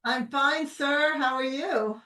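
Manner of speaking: ordinary talk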